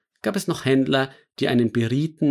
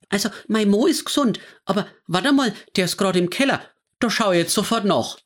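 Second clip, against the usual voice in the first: high, quick Franconian woman; flustered woman checking on her husband; fussy neighbour on the phone; 7 semitones higher, faster than usual. Acted. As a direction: Frau Haas answering grandma's call, puzzled and quick. Frau Haas on the phone.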